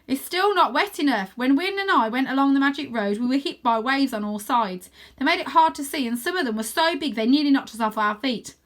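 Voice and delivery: explaining. Acted. Arthur explaining the waves to the girls, earnest. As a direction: make them see the danger. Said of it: "I say".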